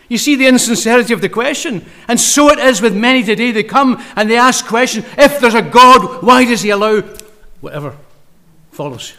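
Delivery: loud, high preaching cry; preaching hard. Real, not acted. Preacher pressing the crowd on insincere questions, loud. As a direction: drive the point home at full force.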